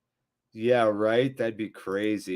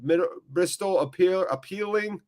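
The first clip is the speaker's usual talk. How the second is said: raised-pitch football commentary; live play-by-play; 6 semitones higher than usual. Real, not acted. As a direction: call the action as it happens.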